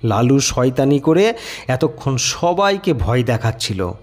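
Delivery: usual speaking voice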